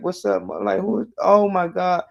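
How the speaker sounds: high-pitched mock amazement, climbing as it goes; amazed reaction. Retelling boys seeing cleaned-up girls at prom, high amazed voice. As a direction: act out being floored, pitch jumping way up.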